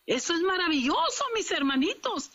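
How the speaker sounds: high, swooping preacher's exclamation; exultant marveling. Real, not acted. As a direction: proclaim the wonder to the congregation.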